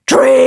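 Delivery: high raspy false chord scream. Shown as a demonstration. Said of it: "mid to high false chord screams".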